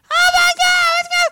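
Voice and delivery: very high, loud squeal of a worried mother; squeaky Italian mom voice. Retold or imitated. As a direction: shriek it like a panicked Italian mama. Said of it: "She has those squeaky Italian voice".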